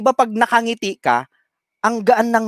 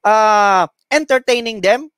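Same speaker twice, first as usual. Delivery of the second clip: ordinary delivery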